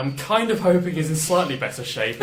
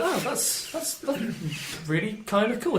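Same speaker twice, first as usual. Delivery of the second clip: much higher, slow, awed character voice; impressed Roar voice; 9 semitones higher, slower than usual. Acted. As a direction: marvel at the gadget, high and slow. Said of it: "he's sort of like".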